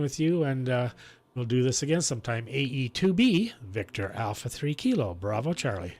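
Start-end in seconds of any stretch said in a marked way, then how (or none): none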